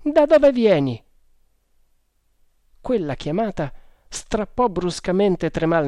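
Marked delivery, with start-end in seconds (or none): 0.0-1.0 high, loud calling voice; shouted question. A narrator's reading. Sergeant calling to the hunter coming from the jungle, shouted. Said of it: "gli gridò".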